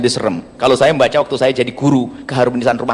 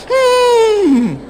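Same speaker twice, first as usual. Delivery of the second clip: mock whimper. Husband's frustrated complaint acted out.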